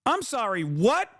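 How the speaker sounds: imitating startled listeners, voice jumping up; high, rising mock-shocked questions; mock disbelief. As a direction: ears perking up, a put-on incredulous double take. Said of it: "makes people's ears perk up. They go".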